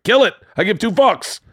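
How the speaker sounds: loud, high-pitched angry outburst; pissed off. Retold or imitated. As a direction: yell it in exasperated fury, retelling. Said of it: "I was so fucking pissed".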